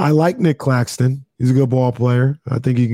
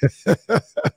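high laugh; 8 semitones higher than usual. Real, not acted.

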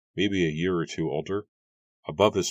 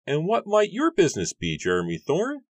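much higher, put-on voice for the farmer; gruff farmer Matthew; 13 semitones higher, 5 dB louder than usual. A narrator's reading. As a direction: play the grumpy farmer, pitched way up. Matthew questions the young man.

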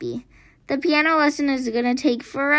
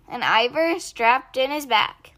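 ordinary delivery

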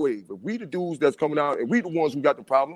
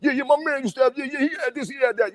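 much higher, hammed-up show-off voice; mock bragging brother; 10 semitones higher than usual. Host mimicking fake brothers hyping his past, hammed-up high voice. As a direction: parody a fake friend gushing about what you had. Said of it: "they bragging about you".

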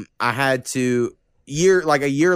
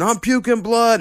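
higher, mock screaming friend; 10 semitones higher, slower than usual. Drunk friend in the cell, mock screaming. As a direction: squeal it like the panicking drunk friend. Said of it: "he kept screaming".

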